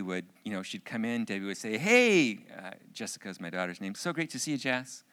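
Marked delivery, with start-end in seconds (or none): 1.8-5.0 high, loud upbeat hello; cheerful greeting. Retold or imitated. Wife greets daughter coming home from school, cheerful greeting. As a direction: imitate an eager mom's welcome.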